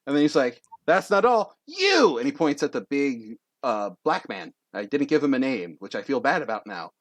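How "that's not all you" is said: high, loud announcement building to a call; single out a target theatrically; showman's flourish. Acted. Young contestant to the next target, showy.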